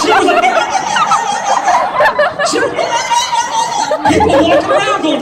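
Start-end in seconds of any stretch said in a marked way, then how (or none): 0.5-2.4 very high, loud yelping laugh; piercing little-dog squeal of a laugh; imitated chihuahua-yelp laugh. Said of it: "sounded like somebody stepped on a chihuahua". 2.8-4.0 very high, loud yelping laugh; piercing little-dog squeal of a laugh; imitated chihuahua-yelp laugh. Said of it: "sounded like somebody stepped on a chihuahua".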